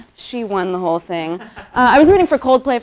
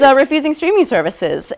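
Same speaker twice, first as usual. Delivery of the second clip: ordinary talk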